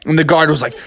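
usual speaking voice

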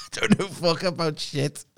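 mock Ozark twang; Ozark accent. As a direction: echo it slowly in an Ozark accent. Echoing the Ozark accent line.

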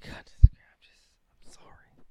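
unvoiced, hushed aside; whispered apology. Real, not acted. Aside apology after joking.

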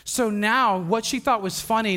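ordinary talk, nothing marked

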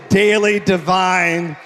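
loud and slow, leading the crowd; preacher calling a phrase for the church to echo; slow preached call. Shown as a demonstration. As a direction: stretch every word out for them to repeat. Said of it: "Come on, say".

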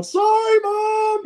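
high shouted sorry mom; shouted apology. Retold or imitated. Rider honking at his marshal mom, shouting sorry. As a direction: call out sorry up to the stands. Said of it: "honking the horn at her going".

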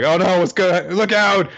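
a high, loud, alarmed yelp; panicked warning. Acted. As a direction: shout a sudden warning to a friend. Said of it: "they're like".

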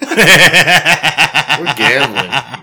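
drawn-out laughing shout. Real, not acted. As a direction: laugh it out slowly. Friends egging on a bet.

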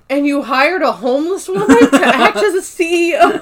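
high, loud, laughing mock disbelief; imitated indignation, half laughing; incredulous outrage. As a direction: pitch it up as an appalled question. Acting out Danny's outraged disbelief.

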